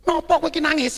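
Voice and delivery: loud, high acted question; baffled friend. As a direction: imitate a pal puzzled by tears. Friend baffled by his crying.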